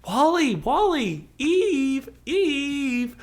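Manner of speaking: very high, loud, tiny robot calling his name; Eve's robot voice. Retold or imitated. Eve calling to Wall-E in her high robot voice. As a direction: squeak his name out like a worried little robot. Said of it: "And Eve's like".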